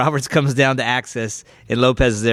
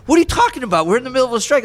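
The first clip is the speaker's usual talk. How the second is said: incredulous friend; 9 semitones higher, much faster than usual. Retold or imitated.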